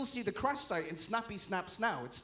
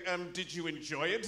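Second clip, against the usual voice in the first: lightly played judge, lower in pitch; 6 semitones lower than usual. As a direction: question the witness as a low-voiced judge. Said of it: "judge is there going".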